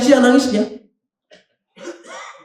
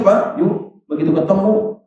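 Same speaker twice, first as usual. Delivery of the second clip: ordinary talk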